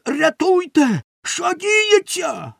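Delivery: very high cries for help; panicked shouting. A narrator's reading. Tar-drenched baker calls for rescue, panicked shouting. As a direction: shriek in helpless bewilderment. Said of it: "закричав Бомштекс".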